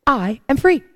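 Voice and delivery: normal talking voice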